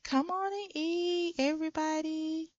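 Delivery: high singsong summons; put-on high hostess voice; calling to dinner. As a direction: call the guests in, high and lilting. Sim calling guests to the meal.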